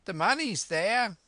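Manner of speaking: high, loud shouted answer; high-pitched sailor's call; calling back. A narrator's reading. Man calls down from window.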